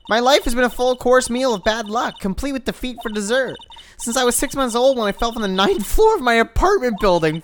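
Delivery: dogged optimist cop rallying herself; Maggie voiced. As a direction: play the cheerful hapless cop, bright and loud. Reading Maggie's lines in voice.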